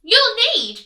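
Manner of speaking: high, loud teasing pig; smug piglet; cheeky pig. Acted. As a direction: taunt him. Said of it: "pig said".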